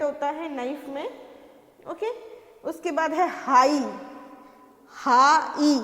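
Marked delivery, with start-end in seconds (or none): 4.9-5.8 हा ई split into two loud parts; syllable by syllable. Shown as a demonstration. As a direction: break हा ई apart for the class. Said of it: "जी silent है इसमें". (अक्षर अलग-अलग बोलो)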